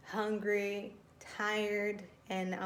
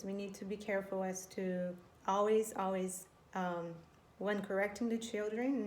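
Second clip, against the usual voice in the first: ordinary talk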